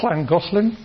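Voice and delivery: Llangollen with a hissy Welsh LL; lateral fricative. Shown as a demonstration. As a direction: air let out past the sides of the tongue on Llangollen's LL. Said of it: "those are lateral fricatives".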